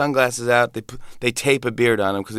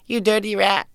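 higher clipped gangster snarl; James Cagney impression; 8 semitones higher than usual. Shown as a demonstration. The host shows his toddler Cagney, much higher. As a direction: spit it like a thirties movie gangster. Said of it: "[name], do James Cagney".

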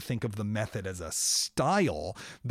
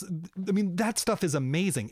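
ordinary delivery

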